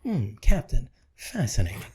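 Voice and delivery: calmly fascinated Vulcan; deadpan Spock. Retold or imitated. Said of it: "he'd say".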